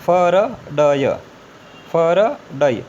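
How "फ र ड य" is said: फ, र, ड, य each consonant said separately and firmly; letter by letter. Shown as a demonstration. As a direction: tick off फ, र, ड, य one by one. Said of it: "हमने पढ़ा कैसे". (अक्षर-अक्षर जोर से पढ़ना)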